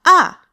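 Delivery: short loud "Ah" falling sharply from high; striking fourth tone. Shown as a demonstration. Teacher demonstrating Mandarin fourth tone, sharp falling "Ah". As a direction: strike "Ah" down hard from a high start. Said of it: "you're striking down".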